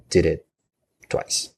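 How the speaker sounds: usual speaking voice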